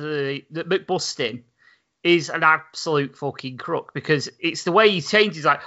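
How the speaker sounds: usual speaking voice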